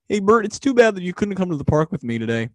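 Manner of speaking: lightly voiced Ernie; playful Ernie impression. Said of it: "you do the voices very well".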